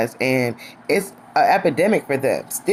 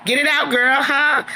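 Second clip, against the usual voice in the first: mocking taunt; 8 semitones higher than usual. Mocking Troy's stammer.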